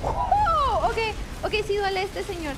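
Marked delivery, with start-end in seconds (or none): 0.0-0.9 high, loud "oh" of shock; startled shriek. Real, not acted. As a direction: let out a high yelp as if hit. Hit hard by the enemy. (chillido agudo de susto)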